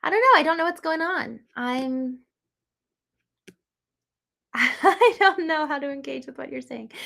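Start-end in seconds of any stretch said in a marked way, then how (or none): 4.5-6.9 laughing through the words, higher voice; real awkward laugh. Real, not acted. Host admits to a chatter she is lost, laughing. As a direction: start on a laugh and talk through it.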